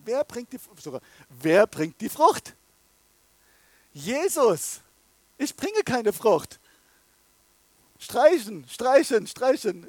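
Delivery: loud, high, emphatic preaching; preacher striking out false theology; full-force preaching. Acted. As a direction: hammer it home from the pulpit.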